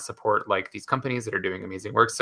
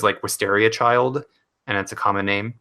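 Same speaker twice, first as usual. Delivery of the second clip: ordinary talk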